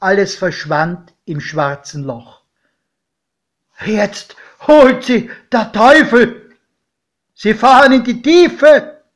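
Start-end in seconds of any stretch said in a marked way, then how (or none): 3.8-9.0 high, very loud outcry; alarmed shouting. A narrator's reading. Godfather watching the train vanish underground, shouting in alarm. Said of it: "schrie mein Pate".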